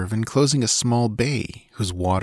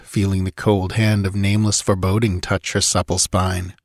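ordinary delivery, nothing marked

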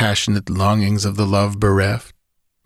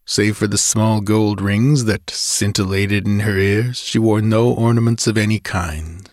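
ordinary delivery

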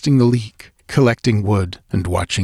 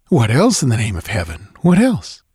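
scoffing disbelief; 3 semitones higher than usual. Acted. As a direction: scoff at the silly question. Narrator scoffs at the idea.

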